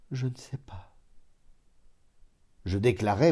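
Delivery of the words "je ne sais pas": soft, hesitant murmur. A narrator's reading. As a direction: cousin murmurs she doesn't know, quietly. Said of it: "puis murmura".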